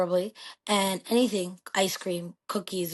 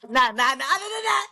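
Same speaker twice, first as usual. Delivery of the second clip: playful dismissal; 5 semitones higher, 3 dB louder than usual. Real, not acted. Brushing off the question.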